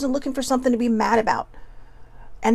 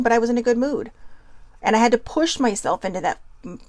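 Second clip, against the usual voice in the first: usual speaking voice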